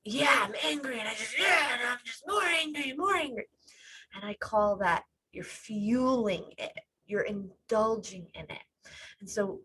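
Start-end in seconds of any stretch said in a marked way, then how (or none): 0.0-3.4 mock angry rant. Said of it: "or anger".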